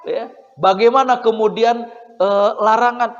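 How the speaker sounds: ordinary delivery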